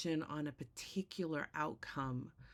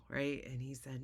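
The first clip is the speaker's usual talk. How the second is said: ordinary talk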